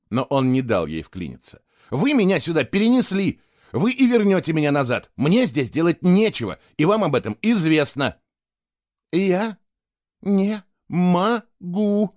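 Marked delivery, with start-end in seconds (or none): 1.9-8.2 loud, high angry tirade; boy angrily confronting his kidnapper; furious accusation. Acted. 9.1-12.1 very slow, high, stretched words; woman talking down to the boy slowly; drawn out words. Acted. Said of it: "растягивала она слова".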